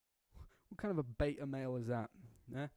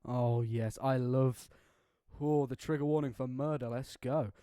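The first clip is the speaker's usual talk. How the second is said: loud, thrilled reaction; loud gleeful cheering; real hype; 9 dB louder, slower than usual. Real, not acted. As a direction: shout it with relish. Reader hyped by trigger warning.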